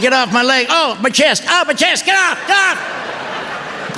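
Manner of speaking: high strained yells of a man in distress; panicked yelling. Retold or imitated. Husband yelling mid-stroke gag, high and strained. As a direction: cry out like you can't breathe. Wife checking on him, choking joke.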